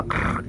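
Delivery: soft, raspy snake "krrr"; imitated snake sound. Shown as a demonstration. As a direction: imitate a snake's rasping noise, softly. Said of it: "Gitu suaranya kayak ular". (menirukan suara ular)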